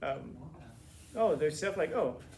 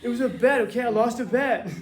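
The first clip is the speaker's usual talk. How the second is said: mock defensive excuse; 7 semitones higher, 9 dB louder than usual. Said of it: "There's a picture of you eating mayonnaise".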